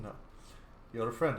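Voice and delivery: ordinary talk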